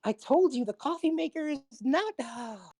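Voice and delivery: a voice caught mid-rant, then dismay; a lightly voiced stranger, complaining, then caught out; overheard voice. Overheard stranger on the stone, lightly voiced complaint. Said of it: "you just hear".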